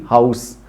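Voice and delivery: हाउस softly, ending in a clear s; house with s. Shown as a demonstration. Teacher softly models हाउस with a final s. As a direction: end हाउस on s, not z. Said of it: "स निकलेगा".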